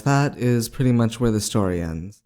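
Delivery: ordinary delivery, nothing marked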